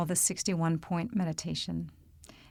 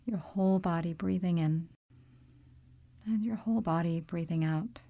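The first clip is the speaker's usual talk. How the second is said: calm, unhurried meditation voice with long pauses; guided meditation. Real, not acted. Yoga teacher leading listeners into body awareness, calm guided-meditation delivery. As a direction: guide them gently through the body, leaving space between phrases.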